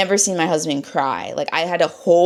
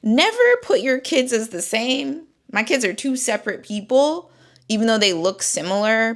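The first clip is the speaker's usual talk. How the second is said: usual speaking voice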